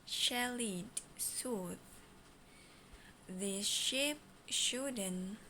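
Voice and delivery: slow, word by word; slow mode. Shown as a demonstration. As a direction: draw each word out slowly. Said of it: "Slow mode".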